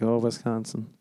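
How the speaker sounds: ordinary delivery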